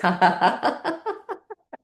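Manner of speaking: long laugh climbing in pitch; deliberate laughter while miming packing; exercise laugh. Shown as a demonstration. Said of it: "all of this is gonna happen with laughing".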